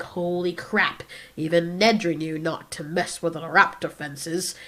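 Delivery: low, soft mock disbelief; hushed awe. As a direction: imitate a stunned viewer, slow then low and soft. Voicing a stunned, awed viewer.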